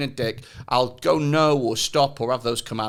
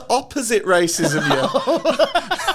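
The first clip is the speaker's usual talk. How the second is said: high, slow, through laughter; laughing protest; 12 semitones higher, 4 dB louder, slower than usual. Acted. Comic replaying his failed chat-up, high laughing protest. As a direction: stretch it out while cracking up. Cracking up at his own line.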